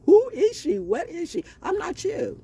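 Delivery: high, put-on taunting voice; high mock taunt. Mocking people who wonder who she is, high put-on voice. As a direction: sing it out like a teasing stranger.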